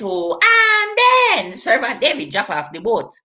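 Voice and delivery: ordinary delivery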